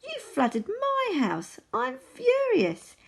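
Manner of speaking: high, slow, drawn-out squeal; furious little mouse, very high and stretched; squeaky mouse. A narrator's reading. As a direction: squeal it slowly in outrage. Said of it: "in her even squeaky voice".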